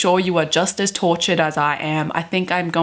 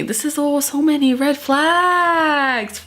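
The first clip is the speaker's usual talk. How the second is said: higher, slow, drawn-out disbelief; exasperated; 7 semitones higher, slower than usual. Real, not acted. Vlogger appalled by the romance, drawling in disbelief. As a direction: stretch it out, appalled.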